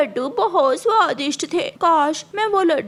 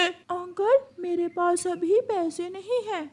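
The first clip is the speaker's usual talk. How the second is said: put-on small child voice; 2 semitones higher, 6 dB quieter than usual. Child pleads softly.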